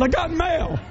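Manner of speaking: high, delighted little cry of surprise; mock excited. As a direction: squeal it like a happy surprise. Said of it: "I said".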